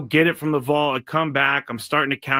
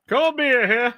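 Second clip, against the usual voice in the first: high, louder beer vendor shout; stadium vendor hawking; 9 semitones higher, 3 dB louder, slower than usual. Pretend. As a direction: echo the beer call like a vendor. Repeats vendor call.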